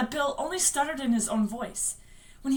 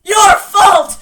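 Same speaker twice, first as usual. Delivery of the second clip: high, full-force accusing shout; shouted accusation; 8 semitones higher, 19 dB louder than usual. Acted. Dead George blaming his brother Bill, screaming accusation. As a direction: scream the blame at him.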